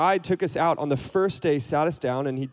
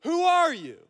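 yelling centurion; 12 semitones higher than usual. Acted. Centurion challenging an approaching rabbi at the gate, yelling. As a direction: yell it out like a sentry on watch. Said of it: "smacks his spear on the ground and yells".

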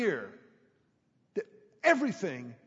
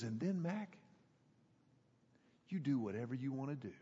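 relaxed pastor aside; 6 semitones lower, 11 dB quieter than usual. Retold or imitated. Pastor relaxing as he tells Mac, lower and soft. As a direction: lower and much softer, easy offhand counsel. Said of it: "He goes".

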